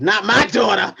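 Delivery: hammed-up protective mom; defensive mother.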